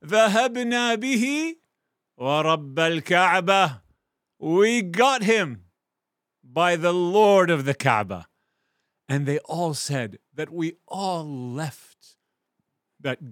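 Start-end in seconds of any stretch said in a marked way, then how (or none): 0.0-8.3 exultant boast; joyful loud cry. Acted. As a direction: cheer like a triumphant family. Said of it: "the response was".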